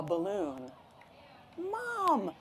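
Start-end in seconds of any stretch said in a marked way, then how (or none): 1.6-2.3 high, drawn-out call; excited yell. Acted. Boy seeing what the barber brought, excited yell. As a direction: sing out high for Mom. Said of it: "I yelled".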